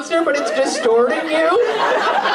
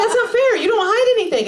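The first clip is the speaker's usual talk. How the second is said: put-on whiny boyfriend; 7 semitones higher than usual. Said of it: "And he was like".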